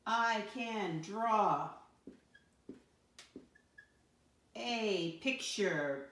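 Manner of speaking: each word drawn out slowly for writing; slow dictation. Shown as a demonstration. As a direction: dictate it slowly so kids can write. Teacher slowly dictates sentence.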